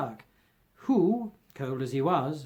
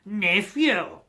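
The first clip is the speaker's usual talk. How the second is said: stern; 5 semitones higher, 5 dB louder than usual. Acted. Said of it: "returned the uncle sternly".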